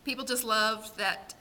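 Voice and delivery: ordinary talk, nothing marked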